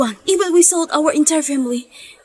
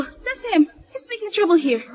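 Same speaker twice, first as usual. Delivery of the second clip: ordinary talk, nothing marked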